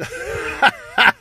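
high, loud sinister cackle; villainous cackle; mean laugh. Acted. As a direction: cackle meanly at the boy. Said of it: "uttered kind of a mean kind of a laugh".

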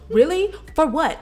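high, loud indignant mock of the girl; indignant comeback. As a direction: fire it back like she can't believe it. Pushed girl snaps back indignantly.

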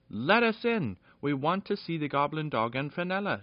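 high, loud demand at the gate; shouting. A narrator's reading. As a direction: pound the gate and yell to be let in. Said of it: "shouted Peter".

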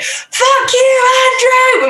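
a real high shout flung out at nothing; screaming into the void. Retold or imitated. Cursing her ex Andrew out in the street, full high shout. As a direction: yell the ex's name into the void, high and loud. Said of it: "we were just screaming it into the void".

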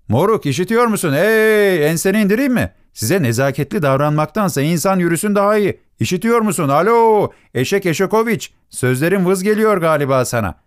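very high-pitched scolding, calling out; angry heckling. Acted. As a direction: holler at someone who won't listen, pitched right up. Hunchback berates the unresponsive old driver. (öfkeli, çok tiz sesle bağırıp azarlama)

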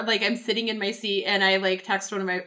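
normal talking voice

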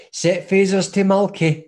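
mock sci-fi captain with a Tayside accent; Tayside starship captain. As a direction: command the bridge in broad Tayside. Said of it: "bridge were all from Tayside".

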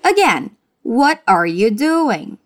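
slow, carefully spaced model line; slow classroom repetition; slow drill. Shown as a demonstration. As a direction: say it slowly so the student can copy.

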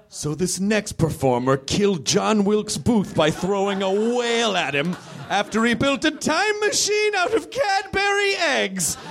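building high, shouted MC intro; emcee introduction. Acted. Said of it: "I asked him what he wanted me to write".

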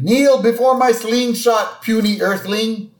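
high booming alien threat; lightly voiced alien invader. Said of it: "the alien's raining".

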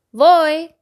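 loud, eager call. Acted. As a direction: shout it out, ready to seek. Calling out after counting.

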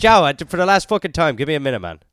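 mock Irish accent, high and loud; exasperated outburst in an Irish accent; McGregor. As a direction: put on a fed-up Irish accent. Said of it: "I followed your accent".

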